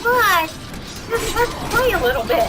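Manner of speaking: teasing taunt. Pretend. Greets boss in teasing voice.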